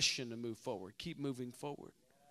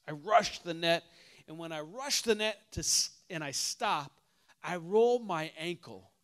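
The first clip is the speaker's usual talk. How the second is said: ordinary talk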